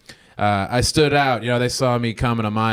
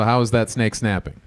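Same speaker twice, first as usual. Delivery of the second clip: ordinary talk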